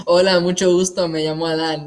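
speaking quick Spanish as a demo. Shown as a demonstration. Introducing himself to show his languages, speaking Spanish. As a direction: introduce yourself in brisk Spanish. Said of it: "This is Spanish".

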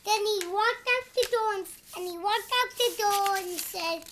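ordinary delivery

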